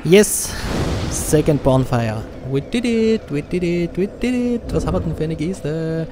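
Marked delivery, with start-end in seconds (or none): 0.0-0.5 loud high yes; triumphant shout; cheering. Real, not acted. Reached bonfire, cheering. 2.5-4.6 high triumphant cheer; excited cheer. Real, not acted. Reached bonfire, cheering.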